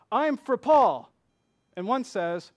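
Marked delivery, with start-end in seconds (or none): 0.1-1.1 slow, loud and higher, like a rally chant; rallying cry. Retold or imitated. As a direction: shout it like a campaign slogan. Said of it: "political rallying cries".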